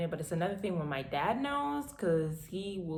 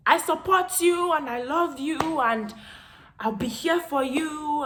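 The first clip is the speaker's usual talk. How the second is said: louder, higher firm repeat; father's emphatic repeat; 8 semitones higher, 8 dB louder than usual. Retold or imitated. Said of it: "can you say that again".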